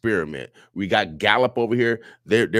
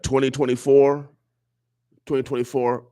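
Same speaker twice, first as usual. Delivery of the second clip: proclaiming; 6 semitones higher, 3 dB louder than usual. Real, not acted. Host proclaims the year.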